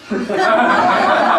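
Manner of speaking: real amused laugh; high laugh. Real, not acted. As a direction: laugh at the absurd answer. Laughing at the police answer.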